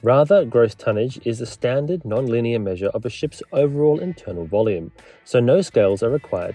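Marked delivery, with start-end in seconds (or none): none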